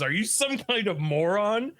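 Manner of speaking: high incredulous anime outrage; indignant retort. Retold or imitated. Quinta answering criticism of his robot, indignant.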